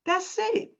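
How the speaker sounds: choked up and tearful; words breaking through tears; ugly crying. Real, not acted. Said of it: "I'm ugly crying right now".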